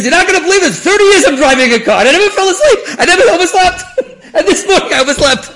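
very high, loud mock shouting through laughter; hammed-up frantic excuse. Driver phoning the waiting passenger about oversleeping, mock shouting with laughter. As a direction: yell the punchline as an incredulous caller.

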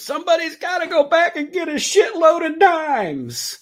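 high, loud exasperated yell; Taggart. Retold or imitated. Movie heel yells back at his gang, exasperated shout. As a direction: holler it back to the whole gang. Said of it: "Taggart, the, the lead heel, looks back and goes".

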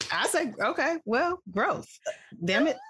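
ordinary talk, nothing marked

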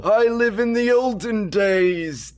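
high, slow proclaiming crier voice; town crier. Acted. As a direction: call out like a village crier. Mock olden-days crier announcement.

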